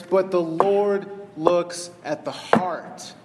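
slow and weighty, each word set down; slow emphasis. Real, not acted. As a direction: lean into the heart, very slowly. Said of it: "the verse I want to emphasize".

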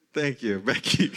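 slow thanks broken by a laugh. Real, not acted. Pastor thanking Becky in the front, laughing. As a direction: crack up while thanking someone. Thanking a vocal congregant, amused.

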